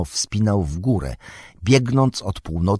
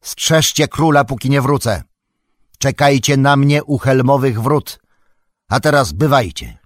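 raised, higher-pitched parting command; urgent shout; 6 semitones higher, 5 dB louder than usual. A narrator's reading. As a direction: call out orders to the whole retinue. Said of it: "krzyknął". (podniesiony głos, okrzyk rozkazu)

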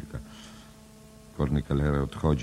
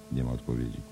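ordinary delivery

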